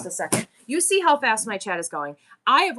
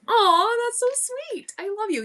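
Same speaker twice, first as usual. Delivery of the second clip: tender aww with raised pitch; touched aw; 10 semitones higher than usual. Real, not acted. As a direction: melt at something sweet. Touched by son's sweet words.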